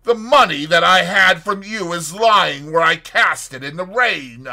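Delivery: loud, high, indignant refusal; outraged cry. A narrator's reading. Fetz refuses money from the doctor, crying out scornfully. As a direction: hurl the offer back with scorn. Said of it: "cried Fetz".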